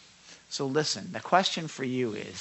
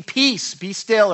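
loud, higher command to the sea; 5 semitones higher, 11 dB louder, slower than usual. Acted. Jesus commanding the wind and sea, loud and high. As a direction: call the storm to order. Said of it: "and said to the sea".

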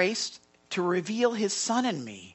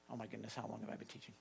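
hushed aside; 9 semitones lower, 22 dB quieter, much faster than usual. Real, not acted. Pastor notices the time.

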